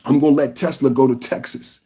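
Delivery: normal talking voice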